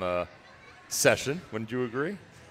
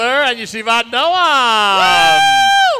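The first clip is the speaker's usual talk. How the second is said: loud, high, slow call-out; shouted announcement; 5 semitones higher, 11 dB louder, much slower than usual. Real, not acted. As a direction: announce the school arriving. Announcing marchers at a parade.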